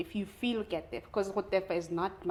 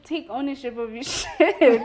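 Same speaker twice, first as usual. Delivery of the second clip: ordinary delivery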